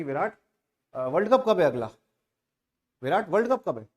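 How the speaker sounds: loud, insistent mock phone call; calling out to Virat. Pestering caller phones a cricket star about the World Cup, calling out loudly. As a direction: call out the question loudly, punching each phrase. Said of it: "Virat Kohli को call करके कह रहा है".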